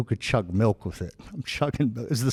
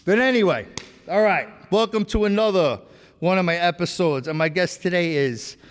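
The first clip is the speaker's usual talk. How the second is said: host intro; 4 semitones higher than usual. Acted. Podcast intro announcement.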